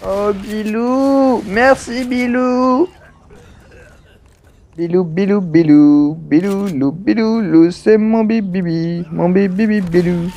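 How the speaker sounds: put-on slow, sing-song baby talk to a pet; lightly voiced doting baby talk, drawn out; cooing baby talk. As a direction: coo over him like a cuddly pet. Cooing at game character Bill.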